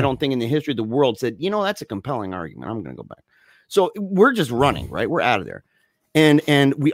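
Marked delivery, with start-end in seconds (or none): 1.4-3.2 low, soft, quick deadpan musing; mock-reasonable. Fleeing kid supposedly persuaded by the pursuer, mock-reasonable. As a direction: drop the voice into pretend thoughtful agreement. Imagined fugitive calmly weighing the order.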